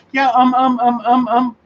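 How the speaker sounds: put-on a fibbing kid searching for words; little kid stalling. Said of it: "I love to act that one".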